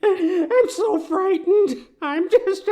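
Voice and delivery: high, slow, crying breakdown; sobbing. Acted. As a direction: break down weeping. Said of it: "leaving Stumpton sobbing alone".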